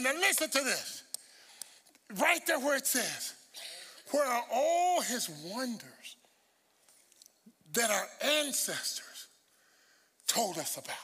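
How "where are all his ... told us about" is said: very slow, measured scripture reading with pauses. Shown as a demonstration. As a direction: spell out the verse phrase by phrase. Rereading verse slowly for emphasis.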